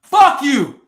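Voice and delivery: loud, furious curse at the comment; angry shout. Real, not acted. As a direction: spit it out loud in anger.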